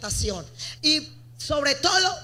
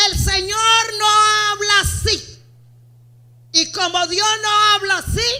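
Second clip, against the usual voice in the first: mock indignant excuse; 5 semitones higher, 4 dB louder than usual. Voicing believer's excuse.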